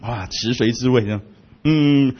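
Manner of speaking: ordinary talk, nothing marked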